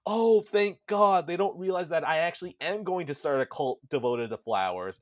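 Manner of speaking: hammed-up relieved cult plotter; relieved outburst. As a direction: burst out in exaggerated relief. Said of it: "the facade dweller goes".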